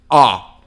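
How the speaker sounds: ó said short and loud; open ó sound. Shown as a demonstration. Teacher demonstrates the open ó vowel. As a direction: snap out a short ó. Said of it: "e tem".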